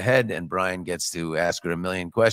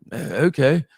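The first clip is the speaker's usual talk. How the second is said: imitated startled reply, higher and louder; 6 semitones higher, 5 dB louder than usual. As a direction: put on his voice for a short reply. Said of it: "And he's like".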